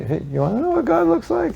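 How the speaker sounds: very high, bright hawker call; street pitch. Retold or imitated. Devotee hailing passersby on the street, very high eager call. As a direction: call out to strangers like a street vendor.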